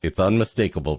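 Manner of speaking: ordinary talk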